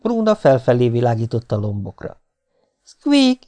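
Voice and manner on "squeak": short, very high squeak; tender high-pitched animal call; gentle dormouse call. A narrator's reading. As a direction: coax the dormouse with a tiny squeak. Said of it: "ismételte halkan, gyöngéden".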